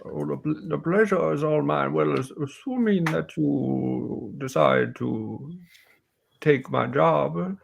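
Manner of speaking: aging warrior, slowly drawn-out words; courteous old warrior offering a job; old warrior woman. Acted. Game master voices Hilin.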